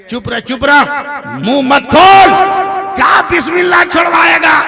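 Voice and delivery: loud, high furious scolding of her husband; angry scolding. Acted. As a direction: shout him down. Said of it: "तैश में कहती है". (गुस्से में डाँटती बूढ़ी बीवी)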